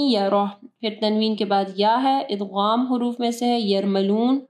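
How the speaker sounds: normal talking voice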